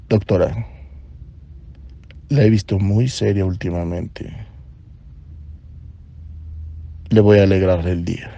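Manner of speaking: a lightly played ladies' man purring; seductive voice. Flirting with the nervous doctor, a light mock-seductive low voice. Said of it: "creo que con esta voz, ¿eh?".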